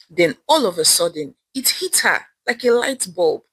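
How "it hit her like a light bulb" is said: usual speaking voice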